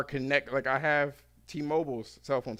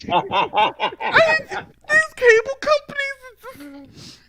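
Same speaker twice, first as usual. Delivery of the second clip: much higher and louder mock wail; hammed-up wailing Karen; 13 dB louder, slower than usual. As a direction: break down dramatically. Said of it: "that's how they say it".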